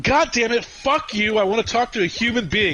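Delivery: high, loud exasperated outburst; retold cursing. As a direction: re-enact cursing out a phone robot. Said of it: "I got into a cursing match".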